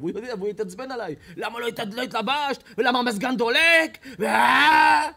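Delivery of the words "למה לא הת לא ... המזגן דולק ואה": higher, much louder furious yelling that cracks into a cry; mock rage rising to a shouting wail; angry father yelling. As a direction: explode at the daughter, building to a howl.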